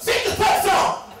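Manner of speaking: high, loud yelled interruption; impatient yell. Retold or imitated. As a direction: yell over the speaker to hurry them. Cuts him off a second time.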